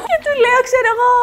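words squeezed through high laughter; laughing, a little embarrassed; laughing while talking. Real, not acted. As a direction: talk through the giggles, pitched high.

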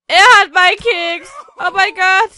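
loud, slow outburst; hammed-up shock; dramatic outrage. Real, not acted. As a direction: shout the revelation like a soap opera. The twin stole his cookie.

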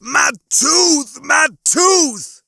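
high, loud repeated wail; yelling. A narrator's reading. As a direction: yell in panic over the tooth. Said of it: "he was yelling".

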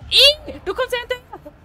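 high shrill panic; frightened shriek. Real, not acted. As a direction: squeal as it follows you. Monster chasing him.

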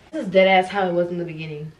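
ordinary talk

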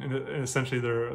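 usual speaking voice

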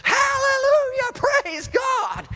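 very high, loud joyful cry, slowly drawn out; ecstatic praise shout. Retold or imitated. Woman overjoyed at getting ketchup shouts praise to God, ecstatic high cry. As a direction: shriek it to the sky in pure joy. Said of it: "this woman literally began to do this".

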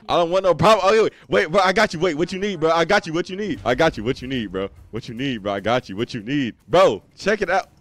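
loud pleading. Real, not acted. Pleading with player not to fight.

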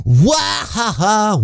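slow, high gleeful villain cackle; triumphant mock-evil laugh. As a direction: cackle like a cartoon thief. Villain laugh escaping with cookies.

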